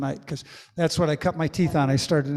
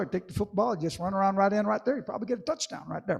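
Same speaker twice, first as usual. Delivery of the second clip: hammed-up Terry Bradshaw impression; 4 semitones higher than usual. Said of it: "sometimes I'll just ham it up".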